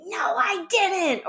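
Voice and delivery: high, drawn-out protesting yell, not full volume; mock child's scream. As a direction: imitate an upset kid shrieking back. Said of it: "if they scream at me and say".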